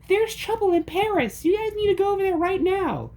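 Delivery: very high squeaky geek voice. A narrator's reading. The geek character speaks.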